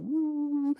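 high, soft, drawn-out mock coo; melting father noise. Father giving in to his daughter, mock helpless coo. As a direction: let out a silly high "woo".

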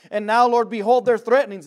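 loud, fervent reading of the prayer; ringing scripture reading. Acted. Preacher reading the apostles' prayer to the church, loud and fervent. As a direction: proclaim the verse with force.

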